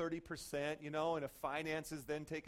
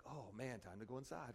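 quiet retreating aside; 6 semitones lower, 11 dB quieter than usual. Retold or imitated. Bystander slipping away.

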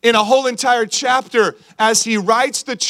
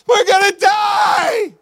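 high, slow panicked shout; 11 semitones higher, much slower than usual. Acted. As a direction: yell it like a castaway sure of doom. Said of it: "He's like".

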